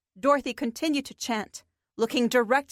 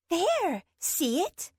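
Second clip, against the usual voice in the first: pixie voice; 7 semitones higher, much slower than usual. Acted. Sparky points out the cave.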